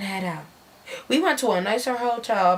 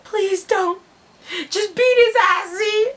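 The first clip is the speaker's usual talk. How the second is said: pleading; 8 semitones higher than usual. Retold or imitated. Jess pleading.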